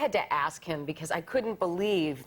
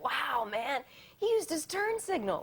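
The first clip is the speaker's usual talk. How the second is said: higher, amazed mock teammate voice; 8 semitones higher, slower than usual. Teammate marveling that Dario signaled, higher amazed voice. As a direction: gape at a turn signal like it's a miracle. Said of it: "He's like".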